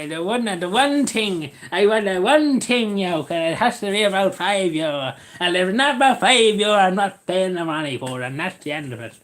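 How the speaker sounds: put-on Cavan accent. Customer refusing over five euro, put-on Cavan accent. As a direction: play a stubborn customer in thick Cavan. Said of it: "I don't know what kind of accent that was".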